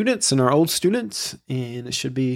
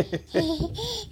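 delighted laugh; 9 dB quieter than usual. Real, not acted. Laughing with baby.

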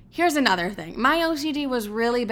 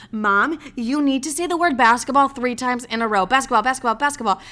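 raised-pitch, urgent instruction to a parent; insistent demand; 4 semitones higher, 5 dB louder than usual. Retold or imitated. Daughter needing mom to repeat a word, insistent demand. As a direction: insist on it, pressing every word.

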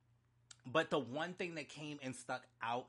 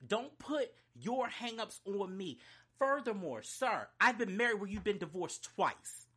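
higher, indignant comeback with punched words; pissed off; 4 semitones higher than usual. Real, not acted. Telling off a twice-divorced man for his hang-ups, pissed. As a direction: throw it back at them, truly angry. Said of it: "I'm so pissed".

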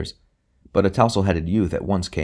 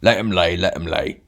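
offhand rough dismissal; lightly voiced brute shrugging it off; careless bully; 3 dB louder than usual. A narrator's reading. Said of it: "said the big man to no one in particular".

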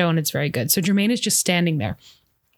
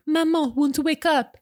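put-on high little-boy voice with a French accent; small French child, pitched way up; attempted French accent; 8 semitones higher than usual. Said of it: "I don't know why I went southern there".

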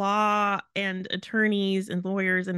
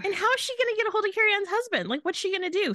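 high, fast disbelieving rant; squeaky, rapid what-on-earth disbelief; incredulous; 10 semitones higher, much faster than usual. Real, not acted. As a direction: pitch it way up in outraged disbelief.

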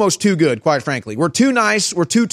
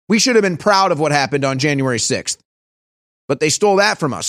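normal talking voice

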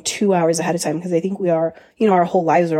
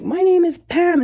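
much higher, a bit louder insistence; mock exasperated woman; 11 semitones higher, 3 dB louder than usual. Interviewer Pam correcting the pestering stepbrothers, mock high insistence. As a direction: squeak it out, fed up.

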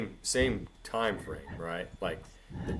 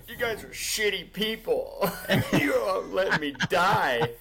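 high, whiny mock accusation as Tasha; imitating Tasha; 12 semitones higher, 5 dB louder than usual. Tasha accusing laughing friends of letting her die, high whiny wail. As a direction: wail it like a betrayed dying friend. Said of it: "It's like".

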